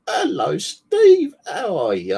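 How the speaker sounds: a strangled high yelp of a hello; nervous greeting. Retold or imitated.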